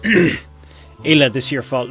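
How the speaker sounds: caveman voice. Acted. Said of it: "I can't do the caveman voice".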